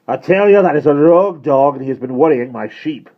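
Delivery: put-on loud, high, heavily accented rage; furious. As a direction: rant in a thick Welsh accent. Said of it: "Pritchard said furiously".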